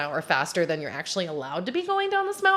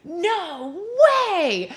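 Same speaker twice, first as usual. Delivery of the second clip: amazed no way; 11 semitones higher, 5 dB louder than usual. Retold or imitated. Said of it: "Shayna looks around and goes".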